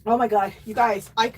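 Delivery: normal talking voice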